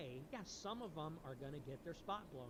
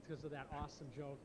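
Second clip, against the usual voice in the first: normal talking voice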